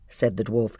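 ordinary delivery, nothing marked

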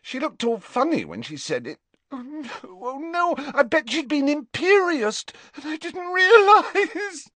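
put-on high, tearful girl's voice breaking into crying; a frightened friend near tears; high girl voice, sobbing at the end; distraught girl.

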